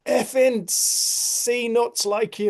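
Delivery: high, loud, drawn-out mock rant; mock angry shout. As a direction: spit it out slowly, imitating an irate driver. Mimics angry Scottish driver's shout.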